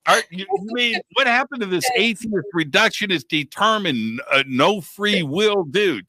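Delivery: high, teasing protest; ribbing the ex-atheist friend, high and indignant; mock indignation. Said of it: "I go".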